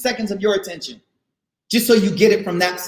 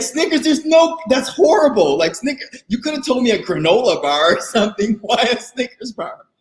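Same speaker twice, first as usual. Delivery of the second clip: wife's amused outrage. Retold or imitated. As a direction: laugh at the silly suggestion. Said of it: "She's like".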